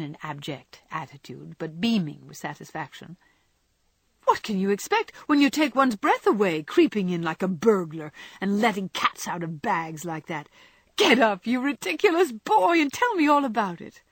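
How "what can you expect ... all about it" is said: high, loud, excited scolding; exasperated Jo. Acted. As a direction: scold the boy in a high flurry. Jo's voice, scolding fondly.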